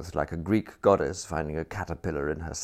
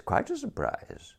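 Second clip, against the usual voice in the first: "quite a surprise" jumps far higher and trails off softer; flustered repeat; 4 dB quieter than usual. A narrator's reading. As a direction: squeak the repeat out nervously. Repeats the phrase, pitched up.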